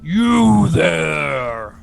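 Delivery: booming giant shout from far above; giant bellow. Acted. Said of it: "He bellows down to you in the common tongue".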